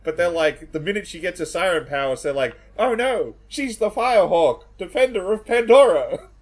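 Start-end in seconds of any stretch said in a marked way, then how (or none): 2.8-6.3 high, loud hammed-up heroic reveal; mock dramatic announcement. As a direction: proclaim it like a cheesy movie herald. Mocking the film characters' dramatic reveal.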